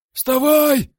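very high, very loud yell; full-throated scream. A narrator's reading. Coach screams at the fallen fighter, full-throated yell. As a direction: scream the command at the top of the lungs. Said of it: "вопила Орлан во всё горло".

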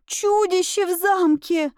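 very high, stretched cry of alarm; wailed. A narrator's reading. Maid raising the alarm about the monster, high wailing. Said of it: "завопила она мне в спину".

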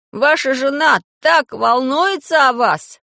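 put-on high, slow, loud boyish call. A narrator's reading. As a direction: shout it slowly across to someone flying nearby. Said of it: "крикнул Пашка Хрусту".

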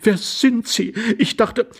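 high, bewildered voice; high-pitched confusion; stunned lady. A narrator's reading. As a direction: ask it aghast, as a startled lady. Lady stunned by strange young man.

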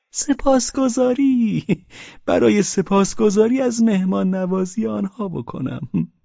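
high, very slow drawn-out gratitude; mocking drunk. A narrator's reading. Pavel mockingly drawls his thanks to Velchaninov. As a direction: stretch سپاسگزاری long and sweet.

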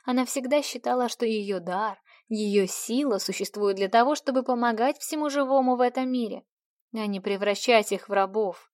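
ordinary delivery, nothing marked

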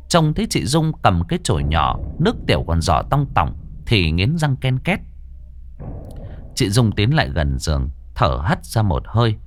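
steady storyteller reading a ghost tale; story narration. A narrator's reading. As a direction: tell it like a spooky radio story. Reads horror story narration. (giọng kể chuyện ma)

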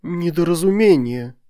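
high, pleading exclamation; imploring. A narrator's reading. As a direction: plead that it was all a mistake. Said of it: "умоляюще начали они".

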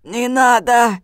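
loud, high choked cry; strained hoarse plea. A narrator's reading. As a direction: plead as if being throttled. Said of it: "прохрипел Мустафа". (хриплая, сдавленная мольба)

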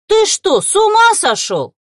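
very high, loud outraged yell; furious roar. A narrator's reading. Woman roars at her partner over his death, furious. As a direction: explode at full force. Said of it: "а взревела".

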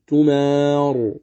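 تمار held long with a heavy ر; heavy recitation. Shown as a demonstration. Teacher showing the heavy ر in تمار at the stop. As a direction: stop on تمار, thick full ر. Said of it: "بالتفخيم".

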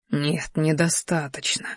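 slow, soft inner reply; hushed and drawn out; quiet refusal. A narrator's reading. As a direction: answer slowly, well under normal volume. Inner reply to his beast.

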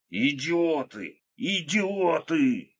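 high, long drawn-out wail; whining. A narrator's reading. Krot hiding behind the table, whining wail. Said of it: "скулил Крот".

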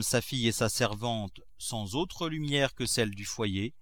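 ordinary delivery

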